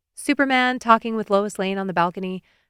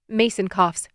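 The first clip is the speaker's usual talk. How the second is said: plain reading voice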